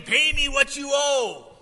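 slow, high, shouted demand; loud, menacing creditor, words drawn out; violent demand. A narrator's reading. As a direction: shout it at a debtor you have by the throat. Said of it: "took him by the throat".

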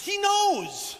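high, loud emphatic cry; exasperated insistence. Real, not acted. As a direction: drive the point home at a shout.

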